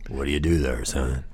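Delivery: low voice of a big bar regular; deep, older man's voice; gruff old biker. Retold or imitated. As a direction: drop down deep, like a Santa-biker stranger. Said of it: "Compared to his voice".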